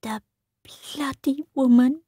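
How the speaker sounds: unvoiced bitter whisper; a boy cursing his stepmother under his breath. A narrator's reading. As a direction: hiss it to himself through tears. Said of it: "he whispered to himself".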